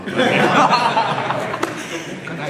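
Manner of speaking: long, high, loud laugh; real laugh. Real, not acted. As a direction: laugh at own punchline. Laughs after punchline. (tawa panjang dan keras)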